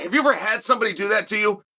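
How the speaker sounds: ordinary talk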